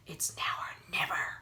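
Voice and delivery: urgent whisper. A narrator's reading. Jives urging Harvey to strike, whispered. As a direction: press him in a whisper. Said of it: "said Jives".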